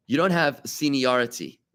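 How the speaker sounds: ordinary talk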